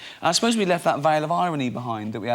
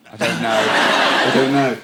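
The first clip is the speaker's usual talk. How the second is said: laughing; 2 semitones lower, 7 dB louder, slower than usual. Retold or imitated. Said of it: "They go".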